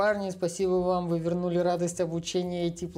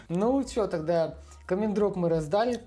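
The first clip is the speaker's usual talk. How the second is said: normal talking voice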